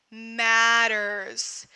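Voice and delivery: ordinary talk, nothing marked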